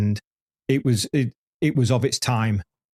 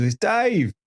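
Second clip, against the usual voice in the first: mock call-out, pitched much higher; 9 semitones higher than usual. Valentino greets Dave by the garage, higher mock call-out. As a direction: hail a friend across the paddock.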